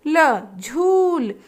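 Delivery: very slow, letter then word; teacher building words slowly; slow sounding out. Shown as a demonstration. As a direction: draw out झूल after ल. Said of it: "उच्चारित करने के लिए कहेंगे".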